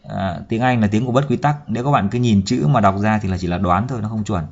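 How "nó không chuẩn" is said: normal talking voice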